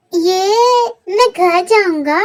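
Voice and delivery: put-on slow tearful child's whine; crying. Lost little boy crying that he wants home, slow tearful whine.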